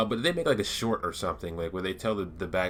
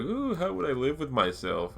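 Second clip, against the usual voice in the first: mocking; 6 semitones higher than usual. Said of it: "mocking her".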